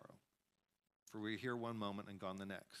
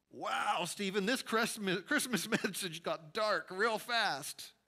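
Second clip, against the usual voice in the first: laughing aside; 8 semitones higher, 7 dB louder than usual. Real, not acted. Self-aside to a colleague, amused.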